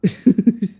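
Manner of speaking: loud, high giggle; laugh. Real, not acted. Storyteller overcome by his own memory, laughing.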